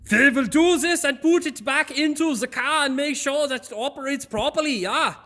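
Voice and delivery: high, forceful mock German accent; aggressive German accent. Mechanic imitating an aggressive German giving orders, in a heavy accent. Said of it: "'cause they're just aggressive".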